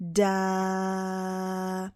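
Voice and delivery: soft drawn-out da with open vowel; sound da. Shown as a demonstration. Teacher showing D plus vowel gives da. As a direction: let the da ring on. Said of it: "I'm going to get".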